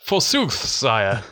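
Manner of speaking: slow hammed-up Victorian gent; hammy stage actor. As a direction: ham up an old-fashioned toff. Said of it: "my Victorian patter".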